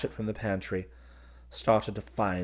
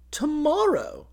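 very high, loud exclamation; excited repeat; 6 dB louder, slower than usual. A narrator's reading. Groom repeating the wedding day to his cousin, thrilled. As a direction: sing out the word in sheer excitement. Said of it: "he repeated".

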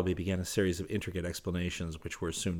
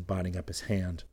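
ordinary delivery

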